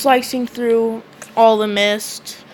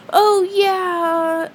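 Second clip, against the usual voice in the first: excited cry; 8 semitones higher than usual. Retold or imitated. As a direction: cheer it out up high. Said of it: "Asta keeps on screaming".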